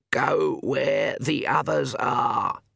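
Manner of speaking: slow, weak croak. A narrator's reading. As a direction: drag each word out of a fevered throat. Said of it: "Granny Weatherwax croaked".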